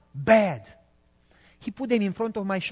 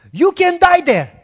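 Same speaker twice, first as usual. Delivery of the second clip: much higher, much louder enraged shout; boss screaming; 10 semitones higher, 11 dB louder than usual. Retold or imitated. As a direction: scream it with full rage. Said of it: "And he started to scream".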